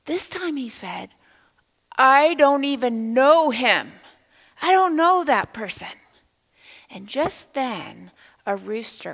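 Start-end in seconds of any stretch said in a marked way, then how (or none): 2.0-6.0 loud denial. Acted. Peter, accused a third time, shouts his denial loudly. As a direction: shout it out to deny him completely.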